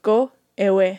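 lightly imitated South African-like accent; echoed movie accent. Host echoing line for listeners to identify, in imitated accent. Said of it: "specifically spoken in this accent and tone".

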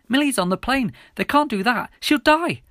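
high, loud desperate protest; panicked. A narrator's reading. Pleading with officer for Millie's life, rising panic. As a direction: protest in mounting panic, pitch climbing. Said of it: "I asked in a panic".

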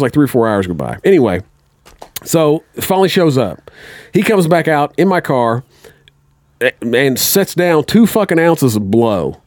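usual speaking voice

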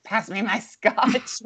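mock gravelly old man asking for scotch, slow; slow, hammed-up smoky voice; gruff scotch drinker. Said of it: "I have a hoarse voice".

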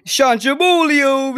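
loud, high, slow mobster name call; mock mobster shout. Mobster character announcing himself loudly. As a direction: belt the name like a boast. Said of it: "your accent".

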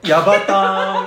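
slow drawn-out gal voice, laughing; kogyaru slang voice. Pretend. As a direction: drawl it like a Japanese gal. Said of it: "コギャル風に言ってみて".

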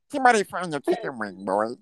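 high, fast squeaky mockery; host teasing the caller in a squeaky voice; mocking high voice. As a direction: tease him in a silly squeaky voice. Mocking voice again.

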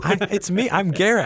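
hammed-up high Garrett voice, not really like him; Garrett impression. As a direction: claim to be Garrett in a silly high voice. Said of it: "You don't even sound like him".

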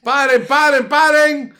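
high, loud retold yell to halt the show; host yells at the dancers, loud and high; shouting stop. Stopping the ballet, retold yelling.

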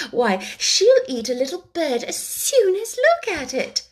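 put-on a child boasting about her pet; eager Alice. Alice telling the birds about her cat, eagerly.

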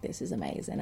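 low, very soft murmur; background aside. Acted. Matt enjoying the uproar from the background, low murmur. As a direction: tuck it away as if from the back. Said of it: "Matt's in the background like".

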